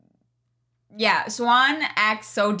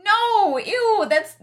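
grossed out; 11 semitones higher, 5 dB louder, much slower than usual. Real, not acted. Disgust at the kink talk.

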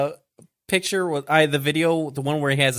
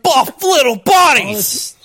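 higher, louder, drawn-out delight; gleeful squeal; 10 semitones higher, 8 dB louder, slower than usual. Real, not acted. Friends joking about buff anime boys, high gleeful squeal. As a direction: savour the words like a treat.

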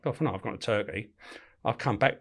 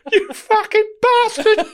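very high, loud, slow, laughing through; mock curse through giggles; 8 dB louder, much slower than usual. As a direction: swear at him mid-laugh. Prisoner cursing, teller laughing.

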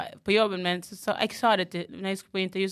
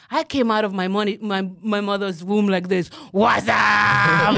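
loud mock boast; 7 dB louder, slower than usual. Mock boast about energy.

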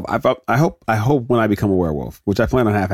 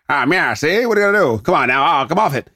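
put-on higher, brash stage-actor talk, old Hollywood style; old-timey movie actor; 5 semitones higher than usual. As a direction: ham it up like an old film tough guy. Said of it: "whenever I was talking like this in movies".

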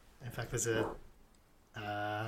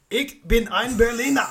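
loud, high-pitched mock German declaration; German accent; 11 semitones higher, 12 dB louder than usual. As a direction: belt it out in a put-on German accent. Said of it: "he's gonna test the accent again".

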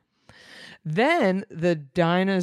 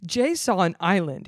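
usual speaking voice

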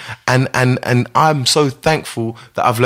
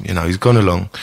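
ordinary delivery

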